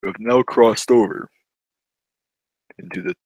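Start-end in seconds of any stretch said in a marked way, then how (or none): 2.8-3.1 spooky announcer. Pretend.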